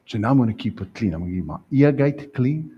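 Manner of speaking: usual speaking voice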